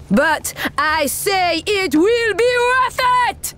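high, slow rallying declaration; drawn-out, rising war cry; rousing climax. Acted. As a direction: build upward to rouse the troops.

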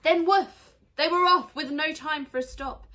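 loud, high burst of excitement; excited. Acted. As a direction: launch it with a loud woof. Dog races off excitedly.

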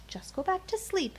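ordinary delivery, nothing marked

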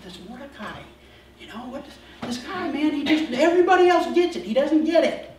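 starts hushed, climbs to a loud high whine; Haman's whiny complaint. Retold or imitated. Haman grumbling to his family, building to a high loud whine.

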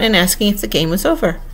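usual speaking voice

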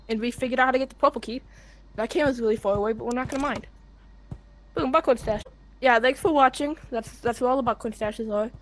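ordinary talk

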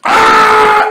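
a sharp, high yelled "Ah!"; sudden shout. Acted. Anime hero bursting out with a high yell. Parody dub, sudden outburst.

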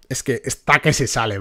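normal talking voice

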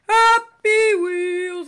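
high, loud, stretched-out game title; Jacksepticeye. Acted. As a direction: draw the words out like a YouTuber intro. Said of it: "let's do the best Jacksepticeye Happy Wheels impression".

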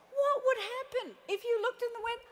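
beaming hello at the salon door; bright greeting. Retold or imitated. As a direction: chirp a hi to a client.

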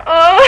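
high laughing squeal, re-enacted; freak-out squeal. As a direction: act out a giddy, high-pitched gasp. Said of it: "doing this".

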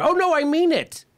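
high-pitched imitation of doubling down; mock insistence. Host acting the candidate answering interviewers, mock insistent. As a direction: leap up high, insisting in mockery. Acted answer of Trump on shows.

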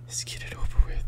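voiceless whisper to oneself. Real, not acted. Player readies self to enter dark room, whispering. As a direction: whisper it under the breath.

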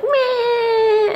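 high, wailing newborn cry; squeaky baby wail; crying baby impression. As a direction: mimic a fussing baby's whine. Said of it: "So the baby's crying and it's like".

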